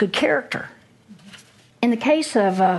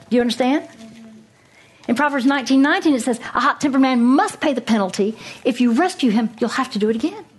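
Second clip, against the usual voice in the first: higher, driving sermon voice; fervent preaching; 3 semitones higher than usual. Acted. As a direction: press the point home from the pulpit. Preaching with rising force.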